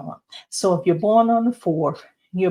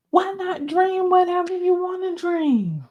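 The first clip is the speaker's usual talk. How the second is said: high-pitched urging; 11 semitones higher than usual. Real, not acted. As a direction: lift it way up, then let the end fall. Playfully urging listeners to dream freely.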